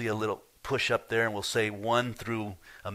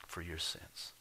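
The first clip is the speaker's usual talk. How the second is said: 1 soft echo; 4 semitones lower, 13 dB quieter, slower than usual. Real, not acted. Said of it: "repeated softer".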